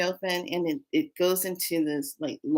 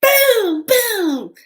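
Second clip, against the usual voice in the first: high, loud booms; acted-out fight noises; punching sound effects; 9 dB louder than usual. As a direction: shout each blow as it lands. Acting out punches in the dream.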